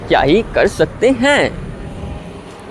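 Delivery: slow, stretched "क्या ही कर सकते हैं"; drawn-out catchphrase. Shown as a demonstration. Vlogger showing viewers their signature line, drawn out slowly. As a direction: draw out each word of "क्या ही कर सकते हैं". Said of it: "इस line पे कभी फोकस करना".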